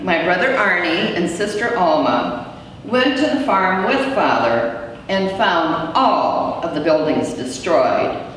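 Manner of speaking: ordinary delivery, nothing marked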